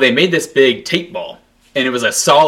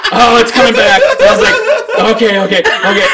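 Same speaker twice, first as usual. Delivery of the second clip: high, laughing "okay, okay, okay"; laughing panic; 9 semitones higher, 4 dB louder than usual. Retold or imitated. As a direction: giggle helplessly as it starts.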